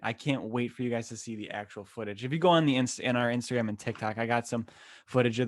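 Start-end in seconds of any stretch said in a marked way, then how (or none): none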